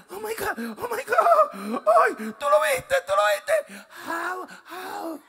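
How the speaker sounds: high, teary, squealing excitement; mock crying with delight over a puppy; gushing over puppy. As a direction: squeal like you are overwhelmed by the dog.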